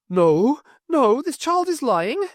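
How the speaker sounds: a mother refusing to hear it; frantic cry of denial; horrified denial. A narrator's reading. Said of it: "staggered back, horrified face".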